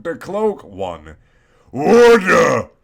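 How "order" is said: much louder, higher barked command; yell. Acted. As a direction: bellow to restore order. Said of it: "he yelled".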